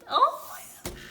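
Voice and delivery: high shrieking laugh, half crying; squealing laugh-cry. Real, not acted. Fan overwhelmed by a photo, squealing laugh-cry. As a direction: lose it completely, laughing and crying at once.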